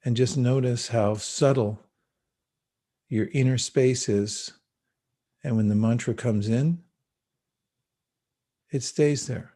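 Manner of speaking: calm spoken meditation with long pauses; guided meditation. Acted. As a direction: ease them gently into the breath.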